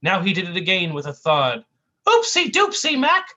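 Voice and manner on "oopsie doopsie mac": high, loud goofy sidekick voice; silly cartoon sidekick. Clumsy sidekick to his partner, goofy high voice. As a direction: squeal it like a clumsy cartoon buffoon.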